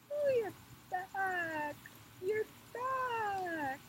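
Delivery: tiny creatures echo the queen's moaning in a high voice. Acted. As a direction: squeak back her moan as little creatures. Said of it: "I want you to say".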